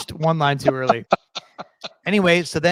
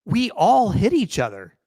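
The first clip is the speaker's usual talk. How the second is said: mock-exasperated, higher voice; pitched-up, heated role-play; playing an angry driver; 7 semitones higher, 3 dB louder than usual. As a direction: argue back in a play-acted spat. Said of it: "So I'm Kyle".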